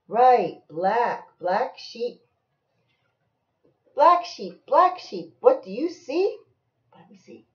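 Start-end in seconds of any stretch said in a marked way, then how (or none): 3.9-6.4 high, loud singsong refrain; bouncy storybook chant; reading to a child. Acted. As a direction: call each name, lift the question. Reading picture book aloud to child.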